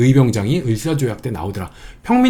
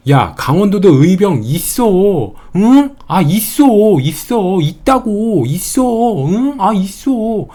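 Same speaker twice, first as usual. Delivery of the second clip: high, drawn-out insistent protest; mock indignation; 5 semitones higher, much slower than usual. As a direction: act worked up, stretching the word endings high. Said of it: "열받아서 이렇게 말하는 거예요".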